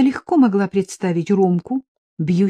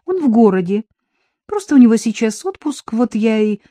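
higher, louder hasty explanation; raised voice cut off mid-thought; flustered, left unfinished; 5 semitones higher, 4 dB louder than usual. A narrator's reading. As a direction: explain hurriedly, then break off. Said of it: "не договорив".

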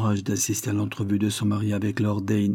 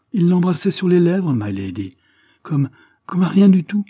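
much higher and louder, scandalized; shocked outburst; 9 semitones higher, 7 dB louder than usual. A narrator's reading. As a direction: burst out as if the kiss were a crime. Servant shocked, reporting the kiss.

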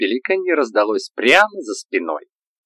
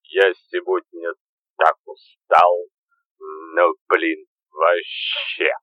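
put-on drunk slurring; 5 semitones lower, slower than usual. Заплетающимся языком.

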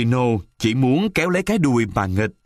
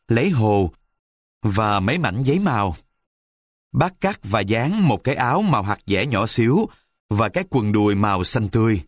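usual speaking voice

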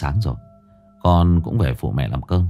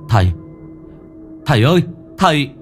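calling out; 4 semitones higher, faster than usual. A narrator's reading. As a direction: call after someone vanishing. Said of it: "vừa chạy vừa gọi".

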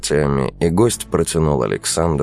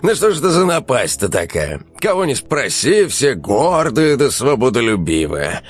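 higher, fed-up amused rant; exasperated; 7 semitones higher than usual. Acted. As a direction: throw your hands up. Эльнур сокрушается со смехом. (с досадой)

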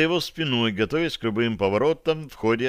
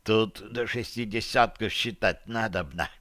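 hushed, softer correction; whispered aside; 5 dB quieter than usual. A narrator's reading. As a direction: discreet stage murmur. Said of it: "прошептал Сивый после того".

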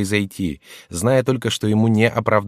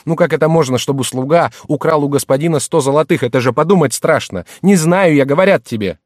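high, loud, exasperated arguing; heated self-defence; indignant protest; 7 semitones higher, 6 dB louder than usual. Acted. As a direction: argue back hotly, rising to a shout. Accused servant argues back indignantly.

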